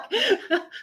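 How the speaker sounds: a high, bubbling laugh that breaks out; high burst of laughter. Real, not acted. Laughing at her friend's joke, high giggle.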